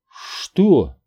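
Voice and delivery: high startled question; choked-out startled reply; strained, forced out. A narrator's reading. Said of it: "наконец сумела выдавить".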